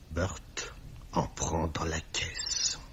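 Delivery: slow, hushed revelation; confiding whisper. Acted. Millet reveals Burt's theft from the till, slow hushed whisper. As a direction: let the scandal out under your breath.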